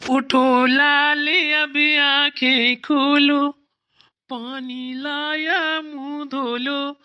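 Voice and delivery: slow, sing-song coaxing to wake her up; drawn-out tender wake-up call; gently rousing a sleepy little sister. Acted. Brother waking sister with halwa.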